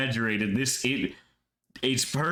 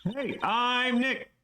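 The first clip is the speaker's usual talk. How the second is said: calling out; 14 semitones higher, much slower than usual. Shown as a demonstration. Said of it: "Maybe I'm not saying it loud enough".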